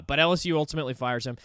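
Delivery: ordinary delivery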